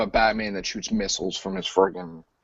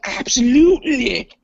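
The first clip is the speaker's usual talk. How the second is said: high, slow, louder put-on welcome; mock hearty welcome; 10 semitones higher, 5 dB louder, slower than usual. Host acts out letting a guest in, drawn-out mock welcome. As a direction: stretch it out in a silly high voice.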